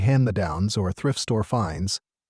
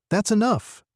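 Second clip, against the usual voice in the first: higher-pitched, firm call; 10 semitones higher than usual. A narrator's reading. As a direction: call a halt with authority. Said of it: "she called out".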